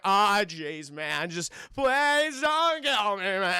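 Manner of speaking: loud, stretched-out mock American whine; goofy American accent. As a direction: plead in an overdone fake American accent. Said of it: "attempts to do an American accent".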